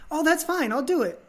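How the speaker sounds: a touch of mock eagerness; eager agreement. Actor eagerly accepting the part.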